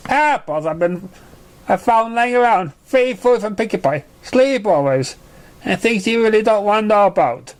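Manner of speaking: lightly voiced filly; young pony answering. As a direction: own up a bit sheepishly.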